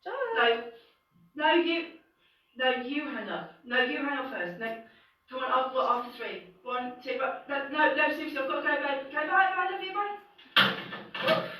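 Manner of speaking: coy sweetheart who won't hang up first; giggly late night call. Acted. Acted lovey phone goodbye.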